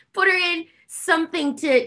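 laughing through the words. Real, not acted. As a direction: breaks into a laugh mid-quote. Laughing while quoting family.

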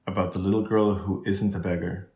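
plain reading voice

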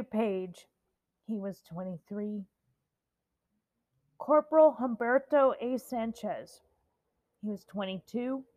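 solemn roll call of the dead; solemn memorial roll call. Acted.

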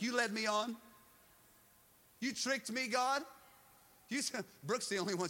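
high-pitched, wounded challenge; acted-out hurt disbelief; aggrieved accusation. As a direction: question God as if betrayed. Acts out feeling deceived by God.

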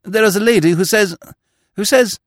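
high-pitched, flustered, halting announcement; stammering attendant. A narrator's reading. Attendant announcing a lady to the magistrate, stammering. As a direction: stumble and repeat in a high, loud voice. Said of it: "stammered the attendant".

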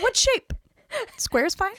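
high voice breaking into laughter; giggly, high-pitched mimicry; playful imitation. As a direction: quote it high, fighting giggles. Continues quote, breaking into laughter.

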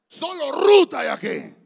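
high, slow, booming shout; triumphant proclamation. Real, not acted. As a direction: proclaim it to the whole church. Preacher proclaims to the congregation. (proclamación a todo pulmón)

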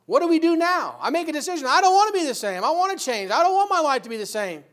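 loud, high, fervent preaching; full-force preaching. Acted. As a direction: preach it hard to the congregation.

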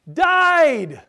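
DIED shouted high and loud, then falling; long, booming stress on DIED; emphatic highlighting. Shown as a demonstration. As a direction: proclaim DIED as the exclamation point. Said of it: "read it in that order".

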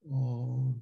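ordinary talk

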